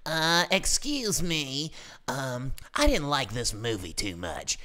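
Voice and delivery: slow, high put-on customer voice; mock prissy customer voice; snooty customer. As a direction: play the fussy customer drawling her complaint.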